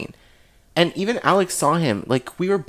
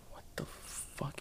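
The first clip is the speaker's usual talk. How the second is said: barely audible, drawn-out whisper; voiceless, stunned reaction; 26 dB quieter, slower than usual. Retold or imitated. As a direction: whisper it slowly in disbelief. Stunned reaction to friend walking out.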